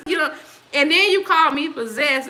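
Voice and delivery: high, loud laugh spilling into the words. Real, not acted. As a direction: laugh through it, amused by the comment. Laughing at a chat comment.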